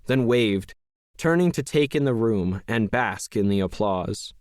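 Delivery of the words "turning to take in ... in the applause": usual speaking voice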